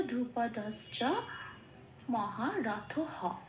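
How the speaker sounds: মহারথ হ with the final হ drawn out slowly; slow recitation. Shown as a demonstration. Teacher reciting the second half slowly. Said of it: "second পার্টটা কি হলো?".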